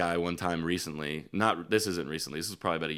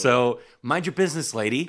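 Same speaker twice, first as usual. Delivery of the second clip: fed-up retort; 7 semitones higher, 7 dB louder than usual. Real, not acted. Telling off the heckler lady.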